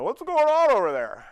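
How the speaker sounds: curious call out. Retold or imitated. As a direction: shout the question over to them. Staff reacting to the scream.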